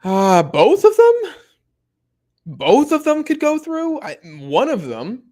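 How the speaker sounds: high, slow, mock disbelief; incredulous. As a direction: stretch it out like you can't believe it. Reading chat in disbelief.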